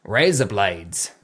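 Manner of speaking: slow, low Australian drawl; put-on Australian accent, dropped low. Shown as a demonstration. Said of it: "razor blades in an Australian accent".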